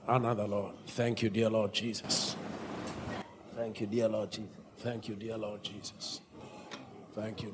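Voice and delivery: prayer. Real, not acted. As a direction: lead them gently in thanks. Leads congregation in prayer and chant.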